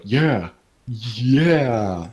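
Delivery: lightly voiced sidekick backing someone up; mock hype man. Little friend backs up the brother, mock hype man.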